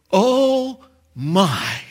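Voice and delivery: high, drawn-out exclamation of awe; awed crowd. Acted. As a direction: act out amazement, slowly. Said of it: "who are gonna be there going".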